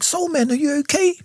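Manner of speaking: high-pitched imitation of worried asking; concerned friends. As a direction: act out friends checking on him, pitched high.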